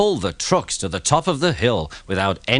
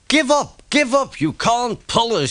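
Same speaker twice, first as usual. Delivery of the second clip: shouting trucks; 12 semitones higher than usual. Acted. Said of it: "shouted the trucks".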